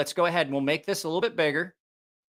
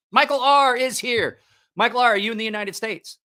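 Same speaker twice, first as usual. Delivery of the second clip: hyped giveaway announcement; 6 semitones higher, 4 dB louder than usual. Real, not acted. Calling out to winner.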